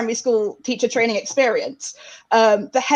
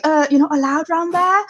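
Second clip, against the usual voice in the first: imitated South East London schoolkid accent; raised-pitch London kid's challenge; 4 semitones higher than usual. Kid at new Bromley school warns newcomer, South East London accent. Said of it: "some kid comes over".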